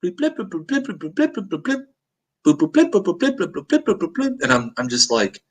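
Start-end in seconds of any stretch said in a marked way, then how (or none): 0.0-4.4 imitated ringtone. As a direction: mimic the phone ringing, high and light. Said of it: "Phone rings".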